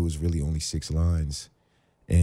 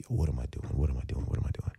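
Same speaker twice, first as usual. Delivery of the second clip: softer each time, sinking into disbelief; fading, dazed muttering; quiet dazed repeat; 7 semitones lower, 7 dB quieter than usual. Retold or imitated. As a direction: repeat it quietly to yourself, stunned. Panic sinking in.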